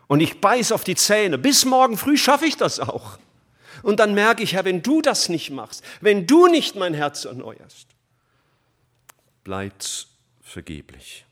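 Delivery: fervent sermon climax; impassioned preaching. Acted. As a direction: preach it at full force.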